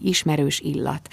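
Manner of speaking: ordinary delivery, nothing marked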